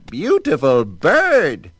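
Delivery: high, loud, slow squawk. Acted. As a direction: crow it out like a delighted witch. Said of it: "she squawked".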